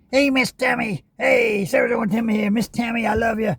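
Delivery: high, goofy mock voice with a shout on the hey; hammed-up imitation calling out to Miss Tammy; a YouTuber mocking Sarasota Tim, high and goofy; Sarasota Tim impression. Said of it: "Grifters Anonymous, he had the glasses on".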